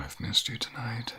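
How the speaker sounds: ordinary delivery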